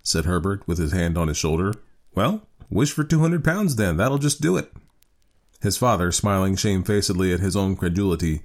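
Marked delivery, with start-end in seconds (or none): 2.1-4.7 put-on higher-pitched, coaxing young man; young son. A narrator's reading. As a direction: cajole father in a lightly raised voice.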